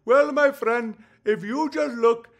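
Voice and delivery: put-on caricatured Indian-accented speaker; mocking Indian accent. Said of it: "I hear an Indian accent".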